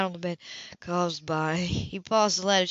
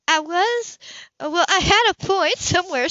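higher, louder, distracted and vague; 10 semitones higher, 5 dB louder than usual. Heroine lost in sensation, higher and vague. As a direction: grope for the thought. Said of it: "she thought vaguely".

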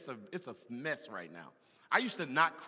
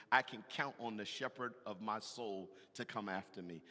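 ordinary talk

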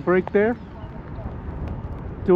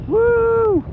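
excited whoop. Real, not acted. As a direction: cheer the jet as it screams past. Cheering full throttle pass.

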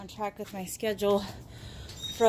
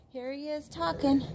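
ordinary talk, nothing marked

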